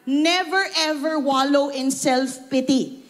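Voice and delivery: ordinary talk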